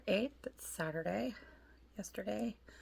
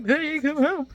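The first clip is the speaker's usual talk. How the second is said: higher, louder pleading call to the cat; retold tearful call; 9 semitones higher, 9 dB louder than usual. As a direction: call the lost cat home, half-crying. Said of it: "tears streaming down my face".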